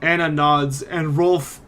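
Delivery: ordinary delivery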